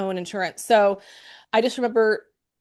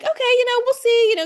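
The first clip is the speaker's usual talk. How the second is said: much higher and louder, a chirpy doctor brushing it off; breezy doctor voice; 6 dB louder than usual. Retold or imitated. Physician casually reassures the patient, chirpy high voice. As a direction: sing it out like a cheerful physician.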